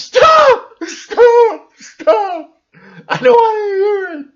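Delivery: hammed-up squeal of someone covering their ears; mock hysterical protest. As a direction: pretend panicked shout begging to stop hearing it. Mocking someone who won't hear.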